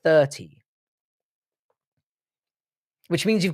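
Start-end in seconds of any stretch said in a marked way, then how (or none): 0.0-0.6 "Thirty" with a careful th; enunciated th. Shown as a demonstration. Streamer explaining the effort of th, carefully enunciated "Thirty". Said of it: "got to really enunciate".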